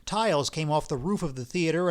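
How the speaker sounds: usual speaking voice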